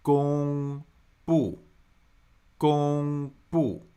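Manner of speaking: 公 held long and level, pause, 布 falling; teacher spelling out the tones of 公布; tone demonstration. Shown as a demonstration. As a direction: split 公布 to show a flat tone then a falling one. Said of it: "That's first tone, fourth tone".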